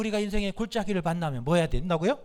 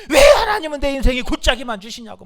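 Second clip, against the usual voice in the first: loud mock protest to God; acted complaining; 5 semitones higher, 9 dB louder than usual. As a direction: burst out like an aggrieved complainer. Acts out someone blaming God. (하나님께 따지듯 큰 소리로 원망하는 흉내)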